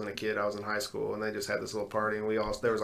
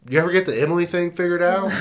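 teasing loud; 8 semitones higher, 8 dB louder, faster than usual. Retold or imitated. Teasing wife after she woke.

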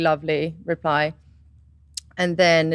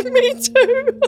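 much higher and louder, through laughter; laughing reply; 6 dB louder than usual. Retold or imitated. Answering her stepmom's text, laughing and much higher. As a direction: giggle it out.

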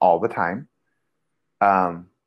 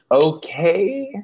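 exasperated confession; 8 semitones higher, slower than usual. Retold or imitated. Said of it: "I was just like".

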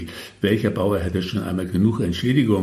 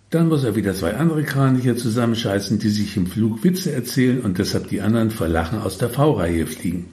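usual speaking voice

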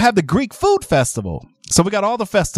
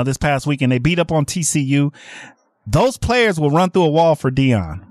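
ordinary delivery

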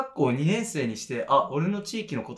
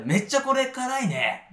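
emphatic exclamation; 10 semitones higher, slower than usual. Acted. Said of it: "例えば、なんか共感した時".

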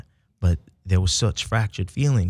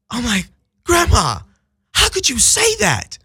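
shocked gasp. Retold or imitated. Grandson stunned by his grandmother's greeting, high and aghast. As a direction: gasp then plead in wounded disbelief.

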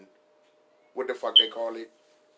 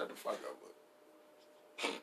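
whispered retort; 9 semitones lower, 12 dB quieter, much slower than usual. Acted. Mock retort.

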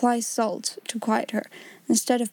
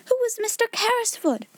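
shouted question; 12 semitones higher than usual. A narrator's reading. Jessie interrupts Ermengarde, mild high shout. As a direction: call it out high and eager. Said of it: "shouted Jessie".

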